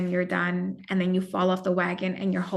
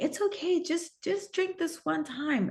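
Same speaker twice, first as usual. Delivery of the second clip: wife mimics her husband coaxing her to drink, higher and softer; 8 semitones higher, 4 dB quieter than usual. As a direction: coax her gently in his voice, higher and hushed. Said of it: "my husband was like".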